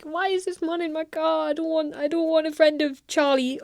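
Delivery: distressed driver. Acted. Imagined Uber driver wanting the stranger out of the car, mock distressed. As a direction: softly whine it, hammed-up.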